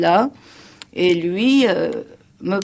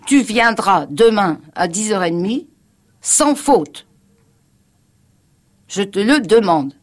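pressing and insistent, a bit louder; retold urgent request from a worried friend; urgent earnest plea; 3 semitones higher, 5 dB louder than usual. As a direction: urge her as if her life depends on it. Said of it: "d'un ton qu'il aurait employé pour une personne en danger de mort".

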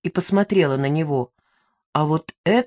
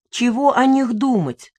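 higher-pitched, mildly snappish comeback; snappish retort; 7 semitones higher than usual. A narrator's reading. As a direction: snap back with a touch of irritation. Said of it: "огрызнулась я".

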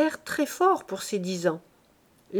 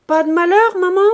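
higher, louder call across the yard; 9 semitones higher, 11 dB louder than usual. Acted. Son calling across the yard to his mother. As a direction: shout the question to her. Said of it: "Il cria pour demander".

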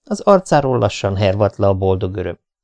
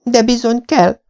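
trembling lips. A narrator's reading. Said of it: "mondta remegő szájjal".

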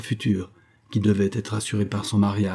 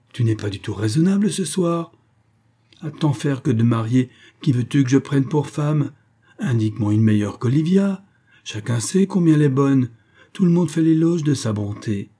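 higher, mildly agitated argument; 5 semitones higher, 4 dB louder than usual. A narrator's reading. Father defending his choice of bride, agitated. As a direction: argue back with some heat. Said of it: "dit-il avec agitation".

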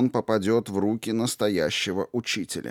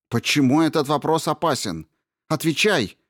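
high, loud frantic shout; frantic shouted demand for an answer; 8 semitones higher, 4 dB louder than usual. A narrator's reading. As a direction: shout it, losing control. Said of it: "выкрикнула она".

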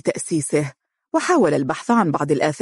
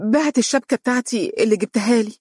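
innocent; 6 semitones higher, faster than usual. A narrator's reading. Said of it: "قالت ببراءة".

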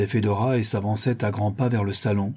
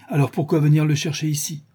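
higher, puzzled questioning; slightly surprised; 6 semitones higher, 3 dB louder than usual. A narrator's reading. Governor questioning Ivan's reason for coming, slightly surprised. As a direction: press the question, a little surprised. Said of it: "un peu surpris".

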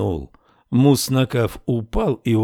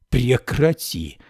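plain reading voice

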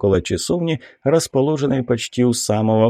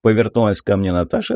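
ordinary delivery

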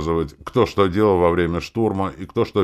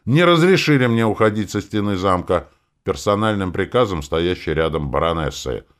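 raised, loud start, easing slightly; angry explanation; 5 semitones higher, 6 dB louder than usual. A narrator's reading. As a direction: explain with open resentment. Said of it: "зло пояснил я". (зло поясняя)

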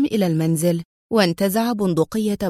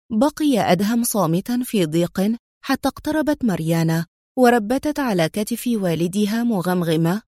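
ordinary delivery

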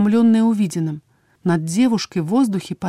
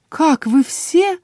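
high, slow questioning voice; bewildered patient; 8 semitones higher, much slower than usual. A narrator's reading. Waking patient questions strangers, high bewildered voice. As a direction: ask it lost and bewildered.

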